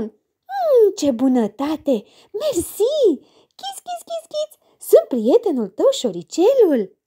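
very high, squeaky mouse voice; thin little voice. Acted. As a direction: squeak like a tiny cheerful mouse. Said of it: "vocea subțire".

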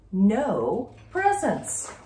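drawn-out, slow, deflated; slow sad refrain. A narrator's reading. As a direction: let each word sink. Refrain of the search.

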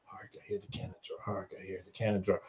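softly muttering lines backstage, unvoiced; murmuring his lines to himself while waiting in the wings; whispered rehearsal. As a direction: whisper the line under your breath. Actor muttering line to himself.

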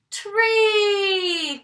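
tree announced with a big reveal; excited. Real, not acted. Teacher naming picture excitedly.

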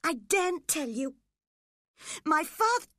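high, loud, terrified gasp. A narrator's reading. Linton too scared of his father to tell, gasping. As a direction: gasp it out in fear, breaking off. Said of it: "The boy gasped".

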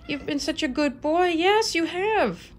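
high sing-song praise for the cat. Real, not acted. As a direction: coo at him like a proud owner. Baby talk to her cat.

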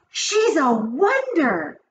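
high, slow, stretched-out gush; high-pitched witchy admiration; admiring cry. A narrator's reading. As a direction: marvel like an adoring crowd. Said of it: "cried the audience".

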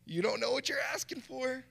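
mock-alarmed pastor warning a churchgoer; incredulous no. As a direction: yelp a disbelieving warning. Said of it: "I'm like".

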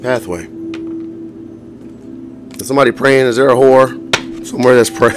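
ordinary talk, nothing marked

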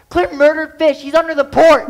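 high, loud outburst at last; shouted accusation. Retold or imitated. Child blurts the blame to mother, a loud outburst. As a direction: burst out with the blame.

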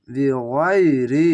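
বিগয়রি very slow with the গয় stretched; slow recitation. Shown as a demonstration. Teacher spelling out বিগয়রি for pupils, slow recitation. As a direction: draw out বিগয়রি slowly. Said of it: "বাজের বি".

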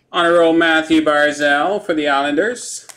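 usual speaking voice

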